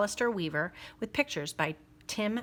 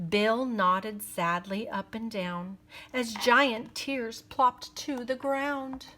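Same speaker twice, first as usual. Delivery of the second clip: put-on lively read-aloud for kids; story narration; 4 semitones higher than usual. A narrator's reading. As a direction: read it like a bedtime picture book. Reading a rhyming picture book.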